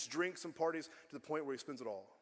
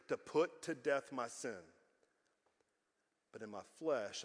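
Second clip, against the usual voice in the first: ordinary talk, nothing marked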